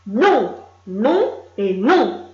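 loud, slow, dug-in no; stubborn refusal. A narrator's reading. Mule Lily refusing the players, loud and stubborn. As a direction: plant your feet and refuse. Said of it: "répondit Lily".

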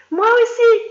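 put-on high, loud eager child cry; exclaiming. Anna the schoolgirl calls out to the adults, high and eager. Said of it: "s'exclama Anna".

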